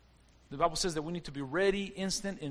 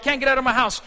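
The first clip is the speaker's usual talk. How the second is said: mock panicked cry; 5 semitones higher, 9 dB louder, faster than usual. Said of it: "You're like".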